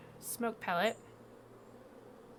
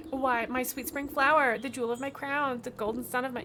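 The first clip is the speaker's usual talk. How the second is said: gushing beekeeper; 5 semitones higher, 7 dB louder than usual. Acted. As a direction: swoon over her. Beekeeper gushes about his queen.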